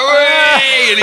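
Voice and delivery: high loud gleeful whoop. Retold or imitated. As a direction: whoop like the pleased dwarf. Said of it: "the was like".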